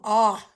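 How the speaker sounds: short open ah, drawn out for the class; ah sound. Shown as a demonstration. Teacher sounding out the vowel for spelling, open ah. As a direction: say "ah" wide open like a doctor's check. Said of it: "Now it sounds like an O".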